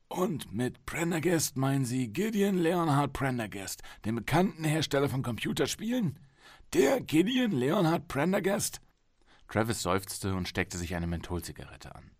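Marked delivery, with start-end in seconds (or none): 0.1-8.8 incredulous higher doctor voice. Acted. Psychologist incredulous.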